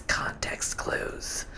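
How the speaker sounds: whispered aside, slow and breathy; slow mocking whisper. Real, not acted. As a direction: hiss it as a secret jab. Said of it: "whispered aside".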